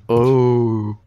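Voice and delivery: laughing. Real, not acted. Laughing at game moment.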